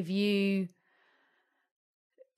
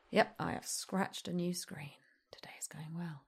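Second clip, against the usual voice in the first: dry whispered grumble; whispered aside; 7 dB quieter than usual. Real, not acted. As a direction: whisper it to yourself, deadpan. Scratched new screen, muttering aside.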